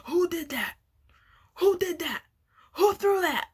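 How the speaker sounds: high, rising angry yell; retold yelling. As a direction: yell it like a furious teacher. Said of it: "And he was just yelling".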